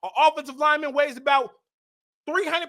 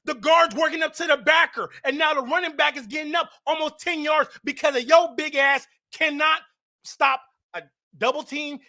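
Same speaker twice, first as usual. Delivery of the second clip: ordinary talk, nothing marked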